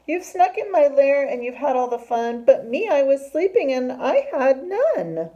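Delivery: loud, high, aggrieved complaint; wailing bear. Acted. Bear tells friends they had all the fun, wailing complaint. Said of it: "he whimpers and he moans and he wails".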